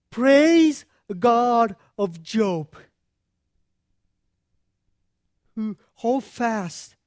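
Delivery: slow, loud exultant praise; angels' praise. Acted. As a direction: proclaim it like heaven cheering.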